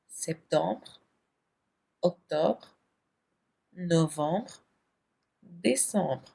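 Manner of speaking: the months recited softly, each with a rising tune; soft drill recitation. Shown as a demonstration. Teacher leads the last review of the French months. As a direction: say the months gently for students to repeat.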